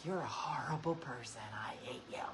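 whispered insult. Retold or imitated. Sister whispering insults at sister, hushed and mean. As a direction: whisper it like a secret jab.

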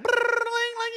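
high trilled ring; imitated bell ringing. Joking friend miming a bell for service, imitated ringing. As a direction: roll the tongue into a high jingle.

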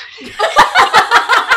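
loud burst of real laughter; big laugh. Real, not acted. Laughing loud at the date's confession. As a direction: crack up out loud at the absurd confession.

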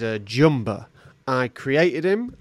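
normal talking voice

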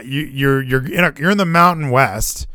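ordinary delivery

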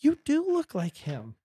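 high, slow imitation of mom; mom's reply. Son quoting his mom's reply, high and slow. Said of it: "And my mom's like".

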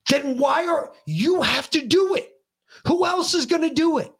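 high-pitched, impassioned retold rant; pissed-off tirade. Dad rants at son about his generation, pissed-off tirade. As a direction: re-enact a furious lecture, drawing out key words. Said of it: "went into another tirade because I was fucking pissed".

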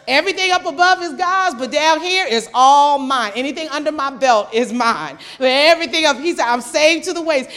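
loud, emphatic preaching; preaching at full force. Real, not acted. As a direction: drive the point home to the congregation. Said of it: "preaching at full force".

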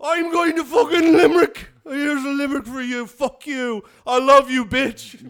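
put-on loud, high drunk Irish rant; drunk Irishman. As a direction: bellow like a drunk Irish bloke. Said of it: "an Irish drunk bastard".

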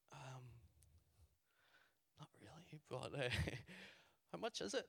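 soft, hesitant, rising in pitch; nervous reluctance. Retold or imitated. Asked to buy a stranger's CD, nervously hesitant. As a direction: hedge quietly with awkward pauses.

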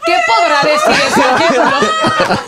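dramatic mock voice. Mocking dramatic question.

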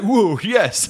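cheering. Retold or imitated. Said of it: "He was like".

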